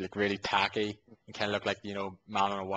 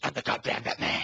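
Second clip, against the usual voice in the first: growled, gruff superhero impression; rough, rasping mock Batman voice. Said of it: "Kristen Bale's gruff voice".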